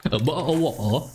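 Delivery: hammed-up British nonsense syllables; mock British accent. Streamer repeats the joke accent for viewers, mock British accent. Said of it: "Logatnya pakai logat British kah?".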